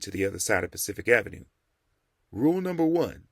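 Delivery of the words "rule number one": put-on higher, reciting old preacher. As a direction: recite the rule like a lesson. The Rev's voice, quoting a rule.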